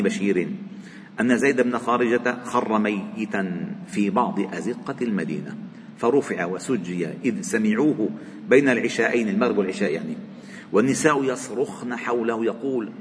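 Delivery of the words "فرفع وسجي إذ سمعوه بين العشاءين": ordinary talk, nothing marked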